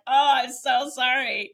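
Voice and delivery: high, loud, hammed-up sorry; laughing it off with a theatrical sorry; mock apology. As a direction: gush a comic over-the-top apology.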